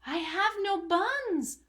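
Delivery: high, slow timid squirrel; squirrel reply. Acted. Storyteller voicing the squirrel answering the rat, high and timid. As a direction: pipe it up like a small squirrel. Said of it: "the squirrel replied".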